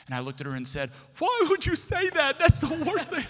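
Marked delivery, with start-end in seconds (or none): 1.2-3.2 very high, loud mock wail; comic high-pitched blubbering complaint; hammed-up whining protest. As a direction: whine loudly as if betrayed. Acted wail at wife's answer.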